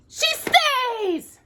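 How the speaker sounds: high loud cheering; high loud joyful shout. A narrator's reading. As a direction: cheer it as the happy dwarfs. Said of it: "shouted the dwarfs".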